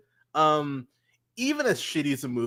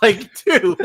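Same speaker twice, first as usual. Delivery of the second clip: laughing; 7 semitones higher, 9 dB louder than usual. Real, not acted. Laughing reaction to painting.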